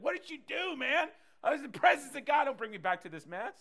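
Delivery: high, loud, hammed-up complaint of someone pulled back from heaven; mock annoyed resurrected person. Pastor voicing a revived congregant griping, high and hammed-up. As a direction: play the irritated dead man dragged back to earth. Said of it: "You'd be like".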